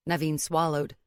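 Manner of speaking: ordinary delivery, nothing marked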